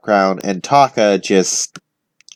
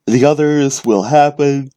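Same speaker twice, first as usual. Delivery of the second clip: talking through a yawn; 5 semitones higher than usual. Real, not acted. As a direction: say it mid-yawn. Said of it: "I am so sorry about the yawning".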